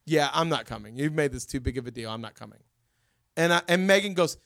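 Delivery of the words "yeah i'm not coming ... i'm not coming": low, soft pouting mutter, dying away; mocking a pouting teenager; sulky refusal. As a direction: pout it out, a hurt kid. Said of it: "he got like this".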